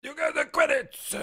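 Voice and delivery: a rough go at the junk dealer's voice; Watto impression. Streamer tries Watto's voice for chat, high and squeaky. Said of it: "Can you do a Watto voice?".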